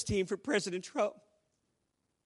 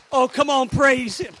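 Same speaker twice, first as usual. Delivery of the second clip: loud, higher call to the crowd; loud praise; 4 semitones higher, 8 dB louder than usual. Real, not acted. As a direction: stir the congregation up with a cry. Preacher exclaims to congregation.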